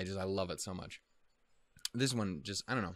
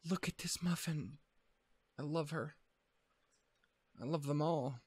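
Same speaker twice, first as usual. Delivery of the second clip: high, softer cooing after a gasp; gushing coo; 6 semitones higher, 3 dB quieter than usual. Real, not acted. Watching anime and gushing over the cute girl, high and soft. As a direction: coo over something adorable.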